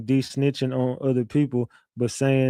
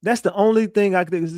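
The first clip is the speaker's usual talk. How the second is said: normal talking voice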